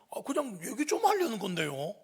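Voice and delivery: put-on teen making excuses. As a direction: play the kid defending himself. Cornered high schooler answers back.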